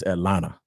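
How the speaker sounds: Atlanta with the second T dropped, "At-lanna". Shown as a demonstration. Host correcting Chicagoans on how locals say it, Atlanta without the T. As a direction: say Atlanta softly, swallowing the T after the N. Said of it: "we don't pronounce the T in Atlanta".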